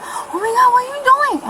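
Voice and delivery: high-pitched mimicry of an alarmed coworker; imitated horrified exclamation; coworker's shocked outcry. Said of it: "She said".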